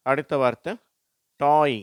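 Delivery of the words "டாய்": டாய் loud, gliding to an "ee" ending; டாய் said emphatically for learners; model reading. Shown as a demonstration. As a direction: read டாய் as an isolated word, "toy". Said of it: "எல்லாமே ஈ அப்படின்னு முடி".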